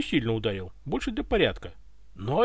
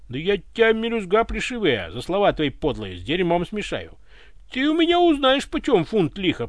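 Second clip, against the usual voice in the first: scolding threat; 6 semitones higher, 5 dB louder than usual. A narrator's reading. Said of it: "приговаривал Пётр, охаживая царя".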